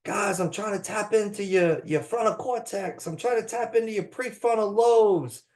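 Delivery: put-on a try at a Cajun accent. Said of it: "I can't do a Cajun accent, I can try".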